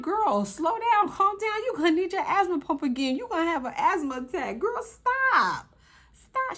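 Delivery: high, loud exasperated scolding of a fighter; mock scolding. Reacting to Anna Mac's fight, high loud exasperated scolding.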